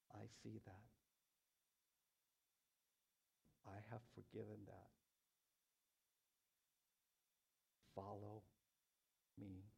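soft, low-key words of Jesus with long pauses; tender divine voice. Acted. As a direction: speak softly as Jesus, letting each phrase settle. Voicing Jesus' gentle call.

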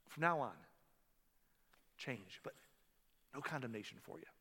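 soft, gentle and low, reassuring her; quiet, tender forgiveness; tender Jesus. Acted. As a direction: speak softly to someone on the ground. Jesus gently lifting the woman.

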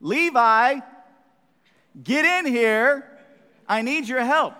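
high, loud, impatient mom shout. Retold or imitated. Mom shouts for Levi to come help, loud and impatient. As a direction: yell for the kid to get in here. Mom calling son by name.

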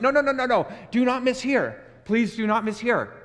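loud, high, pleading no no no; insisting loudly from the pulpit; emphatic loud insistence. Real, not acted. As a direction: stop them hard, do not miss this. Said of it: "pastor insists emphatically".

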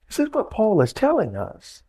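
ordinary talk

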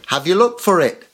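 voicing Mum. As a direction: call back up to the kid as Mum. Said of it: "what does Mum say".

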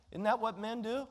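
ordinary talk, nothing marked

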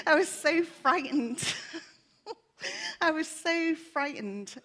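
laughing through tears, high and loud; real emotion breaking through a laugh; choked-up laughter while admitting fear; tearful laugh. Real, not acted.

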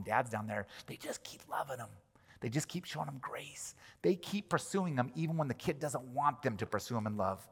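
soft breathy whisper of grace; hushed, intimate sermon close. Real, not acted. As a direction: lean in and whisper it. Tender climax of the point.

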